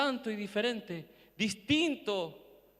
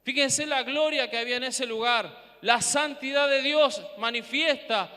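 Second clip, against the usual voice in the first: ordinary talk, nothing marked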